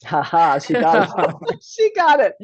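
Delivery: loud, high triumphant laugh; laughing delight. Real, not acted. Host celebrating guest getting her name right, laughing loudly.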